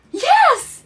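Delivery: very high, loud burst of yes; squealed yes; eager yes. Retold or imitated. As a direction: blurt it out, thrilled. Eagerly agreeing to see future.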